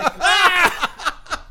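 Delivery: loud, high shriek through laughter; laughing scream. Retold or imitated. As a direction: shriek in mock horror. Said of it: "And I'm like".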